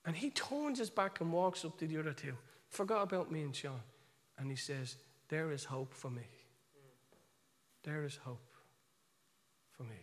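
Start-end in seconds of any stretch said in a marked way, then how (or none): 5.3-10.0 soft, low, halting wonder; hushed realisation. Retold or imitated. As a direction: say it quietly, with long pauses. Retold moment of man finding hope.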